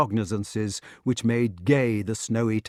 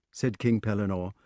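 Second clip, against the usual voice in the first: ordinary delivery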